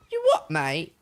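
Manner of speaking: put-on British thug accent that leaps high and loud on the wallet demand; mock British bandit. Streamer mocks game bandits in a hammed-up British thug accent. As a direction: play a dim British highwayman mugging someone. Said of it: "simpleton bandits always have a British accent".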